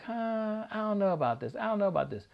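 mock reluctance, unsure of it; doubtful. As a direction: drag your feet into it. Retold doubt at first.

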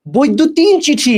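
বৈদ্যুতিন চিঠি read slowly, word by word; teacher slowly reads out the term বৈদ্যুতিন চিঠি as a quiz. Shown as a demonstration. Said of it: "এটা না, এটা কি জানো?".

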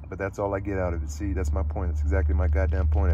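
loud, heated insistence rising to a shout. Acted. Holden yelling his point at Sally in a bar. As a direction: hammer the point home, yelling. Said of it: "Stop screaming at me".

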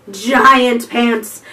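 usual speaking voice

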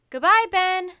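high, loud call across the library. Acted. Librarian calling goodbye to Ben across the library, loud and high. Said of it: "Sandy the librarian called".

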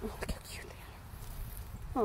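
soft adoring whisper; hushed, gushing over babies. Real, not acted. Whispering near baby animals.

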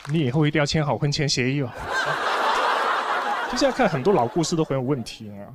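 normal talking voice

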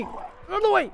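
very high squeal at ordinary volume; high, urgent repeat, held back in volume; panicked squeal. Real, not acted. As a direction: squeal it without shouting. Repeats it while fleeing.

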